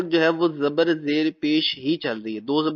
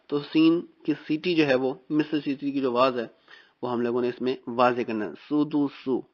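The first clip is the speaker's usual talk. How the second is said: ordinary delivery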